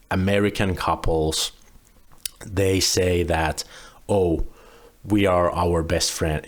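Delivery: normal talking voice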